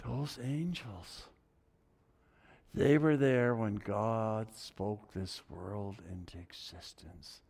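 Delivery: soft, slow, near whisper; hushed awe. Real, not acted. Preacher marvelling softly at the singing angels. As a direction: drop to an awed hush.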